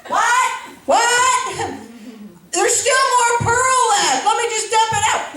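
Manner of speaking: mock shocked mother; mom's reaction. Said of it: "And so she goes".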